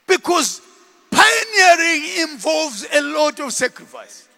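usual speaking voice

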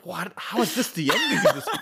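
high, laughing astonishment; delighted shock. Retold or imitated. Retelling watching the film's ending, laughing high shock. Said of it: "I was just like".

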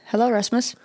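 ordinary delivery

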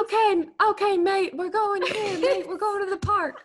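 Australian dad impression. Host imitating Kurt talking to his son, high Australian dad voice. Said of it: "he'll be like".

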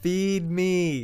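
high demanding plea; cat voice. Retold or imitated. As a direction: whine it like a hungry cat. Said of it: "she responds".

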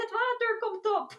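high, mock-panicked cry for help; panicked yell. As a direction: re-enact your own flustered shout in broken English. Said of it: "niin sit mä olin vaan silleen". (esitetty hätääntynyt huuto korkealla äänellä)

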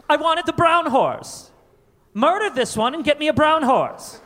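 high whiny begging brat; spoiled rich kid. Acted. A spoiled kid begging Daddy, high and whiny. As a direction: whine to Daddy for the horse.

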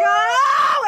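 high loud relieved cry out. Retold or imitated. Finally letting go in the car, loud relieved Oh. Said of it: "I was like".

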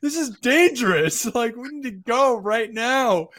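high, loud, urgent warning; alarmed. Retold or imitated. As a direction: warn him, laughing urgently. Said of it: "I'm like".

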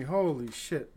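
usual speaking voice

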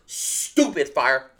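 very slow, stretched-out praise; savoring emphasis. Real, not acted. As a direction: draw out each word, relishing it.

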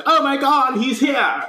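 high-pitched hammed-up relief; mock excited parents. Parents find their son in treehouse, high mock excitement. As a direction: squeal it like overjoyed parents. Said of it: "they're like".

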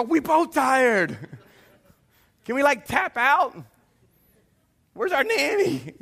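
high, hammed-up parental pleading; mock exhausted whine. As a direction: whine like a parent begging to quit. Mock exhausted parents begging to quit.

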